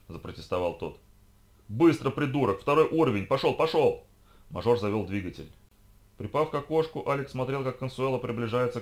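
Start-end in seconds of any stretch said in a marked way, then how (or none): 1.7-4.0 loud, high, impatient command; urgent shouted order. Acted. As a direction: yell at a dawdling driver. Lisha orders driver to take off.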